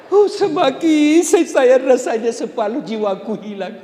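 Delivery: high, hammed-up lament with laughter; mock grieving husband. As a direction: overdo the widower's sorrow. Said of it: "lalu suamimu menangis". (suami meratapi istri, ratapan dibuat-buat)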